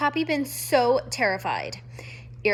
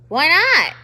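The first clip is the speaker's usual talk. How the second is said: loud, higher sharp question; sharp demand; 5 semitones higher, 10 dB louder than usual. Acted. Porcupine demanding, loud. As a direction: snap it out.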